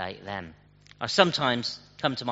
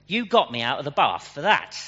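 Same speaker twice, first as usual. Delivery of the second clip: louder, higher mock exasperation; a hint of an annoyed Metro driver; exasperated punchline; 4 semitones higher, 8 dB louder than usual. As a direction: land the joke's punchline indignantly.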